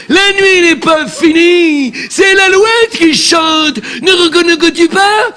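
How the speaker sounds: slow, high, shouted tragic declamation; hammed-up tragedienne; melodramatic Juliet. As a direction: overact a wailing Juliet.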